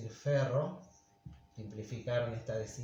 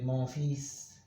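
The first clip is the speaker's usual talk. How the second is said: French pronunciation. Shown as a demonstration. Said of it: "en francés".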